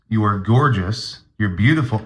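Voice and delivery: ordinary talk, nothing marked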